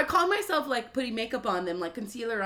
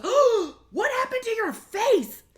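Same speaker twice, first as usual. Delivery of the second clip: hammed-up motherly shock, pitched way up; alarmed mom; 12 semitones higher, 7 dB louder, slower than usual. Mom in the front seat spotting bruise, alarmed. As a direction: imitate a high, loud horrified mother. Retold mom noticing her face.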